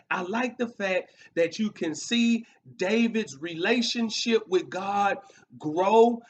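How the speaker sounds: normal talking voice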